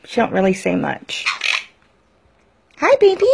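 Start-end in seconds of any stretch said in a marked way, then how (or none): 2.8-3.3 high cooing hello to a bird; pet talk. Real, not acted. Greeting her bird.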